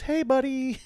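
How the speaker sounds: put-on high, sweet child's voice; lightly voiced pitying child; mock sick kid. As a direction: voice a little kid cheering you up. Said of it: "some kid ... goes".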